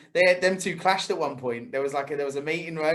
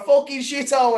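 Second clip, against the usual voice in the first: mock shout out the window; 8 semitones higher, 4 dB louder than usual. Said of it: "literally the window down".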